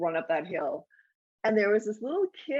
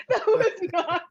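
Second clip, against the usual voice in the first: high, slow words through laughter; can't get it out for laughing; 11 semitones higher, 4 dB louder, slower than usual. Real, not acted.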